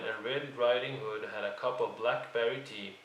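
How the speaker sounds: ordinary delivery, nothing marked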